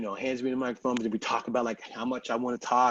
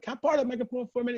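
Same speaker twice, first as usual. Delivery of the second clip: fast, higher request; quick ask; 12 semitones higher, much faster than usual. Retold or imitated. Asking the DJ for the mic, fast. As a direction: rattle it off even quicker. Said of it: "I'm like".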